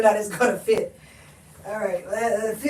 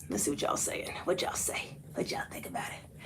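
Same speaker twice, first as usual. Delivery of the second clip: unvoiced whisper to herself; 8 semitones lower, 18 dB quieter than usual. Real, not acted. Reading the live comments to herself, whispered. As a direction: breathe the words, barely there.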